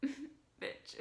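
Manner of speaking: breathy sassy insult; mock offended aside. Retold reaction to friend's comment.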